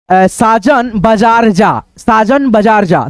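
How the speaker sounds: slow, deliberate dictation of साजन बाजार जा. Shown as a demonstration. Teacher dictating साजन बाजार जा, slow. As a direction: read it out slowly like a dictation. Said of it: "अगर कोई बोलता है लिखने के लिए".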